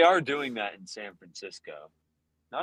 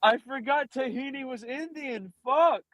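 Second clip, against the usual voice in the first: squeaky shocked outburst; high mock dismay. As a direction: gasp it out in a high voice. Mock dismay over a friend.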